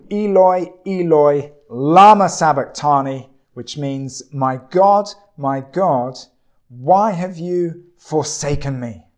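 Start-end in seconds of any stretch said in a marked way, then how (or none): 0.1-3.3 loud cry. Acted. Jesus calls to God from the cross, crying out loudly. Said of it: "cried out in a loud voice". 4.3-9.1 raised, anguished cry of distress; loud cry. Acted. Jesus asks why God has forsaken him, crying out loudly. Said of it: "cried out in a loud voice".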